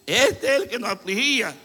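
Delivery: voz más aguda de gente sorprendida; asombro. Acted. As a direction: dilo como quien no puede creerlo. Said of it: "La gente va a quedar sorprendida".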